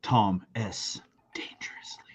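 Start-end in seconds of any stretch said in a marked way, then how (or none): 1.3-2.0 breathy whispered "Dangerously."; whispered catchphrase. Acted. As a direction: hush it out like a secret.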